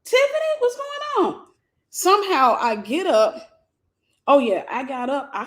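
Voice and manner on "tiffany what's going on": high calling mother's voice; a mom calling from the next room, pitched way up; mother calling her daughter, high and worried. Retold or imitated. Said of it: "I hear my mom".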